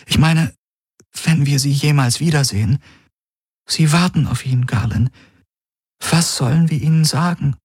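high-pitched, distressed female figure; grieving Kirai. Acted. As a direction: plead helplessly in a high voice. Voices grieving Kirai in dialogue.

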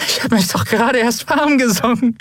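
high-pitched, strained protest; defiant joke in a high, worn-out voice; giddy bravado. A narrator's reading. Giggling prisoner objects to being released, giddy and strained.

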